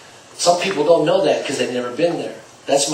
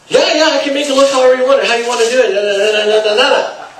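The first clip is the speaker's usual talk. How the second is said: much higher and louder, fast and eager; mock overeager seller, pitch and volume way up; overexcited salesperson; 11 semitones higher, 9 dB louder than usual. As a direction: gush like a pushy salesman. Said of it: "All excited 'cause now".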